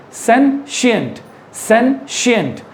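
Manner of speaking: sentient said slowly as SEN-shient; model pronunciation. Shown as a demonstration. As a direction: repeat sentient with a clear sh sound. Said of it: "So this is sentient".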